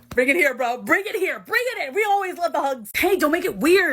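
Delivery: high, loud, playful calling; boisterous. Acted. Friend calling another into a hug, boisterous. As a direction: call them in with big energy.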